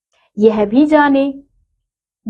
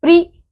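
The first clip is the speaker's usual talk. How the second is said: "Pre" called out higher, pri said crisply; stressed syllable; 7 semitones higher, 3 dB louder than usual. Shown as a demonstration. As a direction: hit the syllable "pre" like a teacher's highlight. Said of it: "री की मात्रा प जमा री".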